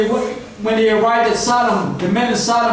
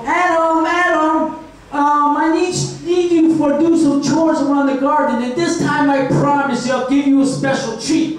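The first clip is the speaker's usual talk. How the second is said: higher-pitched mock Eve voice; Eve calling sweetly; 4 semitones higher than usual. Eve coaxing Adam into garden chores, in a higher put-on voice. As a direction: put on a higher voice and call for him.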